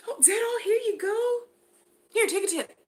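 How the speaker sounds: very high, gushing squeal over the earrings; a thrilled customer shrieking with joy; squealing delight. Pretend. Pretend customer squealing over handmade earrings, high and gushing. Acted customer pays and tips.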